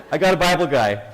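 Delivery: big, eager retold exclamation; excited boast. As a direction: proclaim it like a triumphant punchline. Acted eager offer of help.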